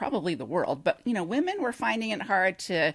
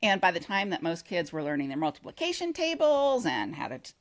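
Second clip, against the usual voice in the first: ordinary talk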